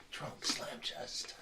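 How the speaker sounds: slow, hushed whisper of the name; dramatic whisper. Shown as a demonstration. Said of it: "It's more like".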